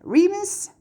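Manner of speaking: a high, gasping name; breathless with sudden joy; gasped. A narrator's reading. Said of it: "Harriet gasped".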